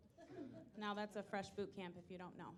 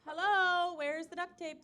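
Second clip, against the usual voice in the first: put-on yelling for the kids; 7 semitones higher, 9 dB louder than usual. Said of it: "so I'm yelling to the kids".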